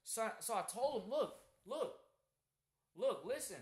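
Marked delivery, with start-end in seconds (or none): 1.1-3.6 retold loud warning. Retold confrontation with coworker.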